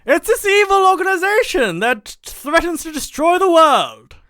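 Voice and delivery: very high, loud, slow melodrama; put-on spy-skit voice. Host warning Bond about an evil organization, high put-on voice. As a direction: drag out the warning in a high, hammy voice. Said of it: "why I'm talking like this".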